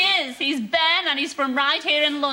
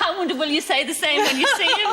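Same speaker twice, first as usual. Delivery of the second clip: normal talking voice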